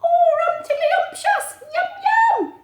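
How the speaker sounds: very high, gleeful mouse squeals; squeaky mice. Acted. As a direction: squeal with delight like tiny mice. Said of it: "what were the mice saying?".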